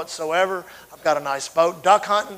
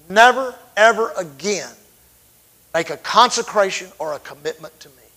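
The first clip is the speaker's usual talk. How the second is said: God forbidding any more vows; firm command from God; 2 semitones higher, 8 dB louder than usual. Retold or imitated. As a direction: lay down the law, word by word. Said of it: "He said".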